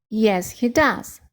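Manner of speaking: ordinary talk, nothing marked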